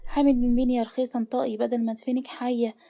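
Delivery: plain reading voice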